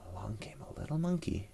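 hushed sneaky whisper. A narrator's reading. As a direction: whisper it like a secret. Sneaky monkey enters.

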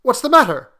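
jolted awake in alarm. A narrator's reading. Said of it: "He started up in alarm".

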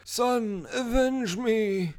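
high, drawn-out dying plea; hammed-up last words, stretched out slowly; dying father. Battered dad's imagined last words.

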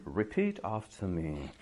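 slow, deliberate TV teacher voice; over-enunciate like a TV language class; mock classroom announcer, slow; TV English teacher. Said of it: "an English class on T V".

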